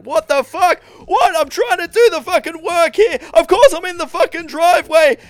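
loud, high furious yelling; high-pitched angry rant into the phone; angry shout. Retold or imitated. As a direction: yell it at the phone in a rage. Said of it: "like, shouting".

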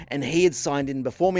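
ordinary talk

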